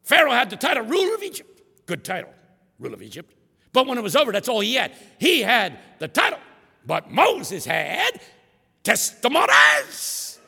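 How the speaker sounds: high, loud preacher's climb; imitating the preacher sweeping through the Bible. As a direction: build the sweep to a shouted peak. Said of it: "Can't you just hear him?".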